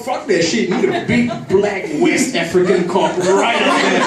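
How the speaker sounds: ordinary talk, nothing marked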